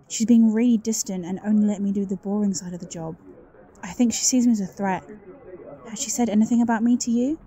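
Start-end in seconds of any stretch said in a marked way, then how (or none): none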